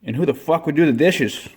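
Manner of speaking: normal talking voice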